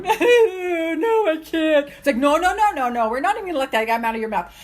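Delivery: loud, high, laughing no; giggling refusal; laughing self-talk. Pretend. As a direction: laugh off the doubting voice. Inner doubt voice, then rebuttal, laughing.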